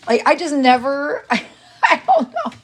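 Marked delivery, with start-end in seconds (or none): 1.3-2.5 high, laughing disbelief; giggling I don't know. Real, not acted. Laughing at trolls' fixation.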